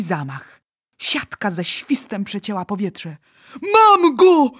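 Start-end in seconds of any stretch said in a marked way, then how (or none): 3.7-4.5 high, loud giant's shout; triumphant cry. A narrator's reading. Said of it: "zakrzyknął olbrzym".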